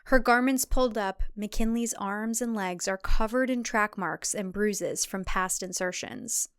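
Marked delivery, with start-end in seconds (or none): none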